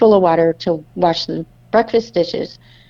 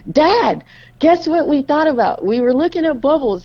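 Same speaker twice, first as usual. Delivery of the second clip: put-on higher, bubbly child voices; excited kids; 7 semitones higher than usual. Children telling dad about their day, excitedly higher. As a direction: burst out like kids with big news. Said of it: "And we're all excited".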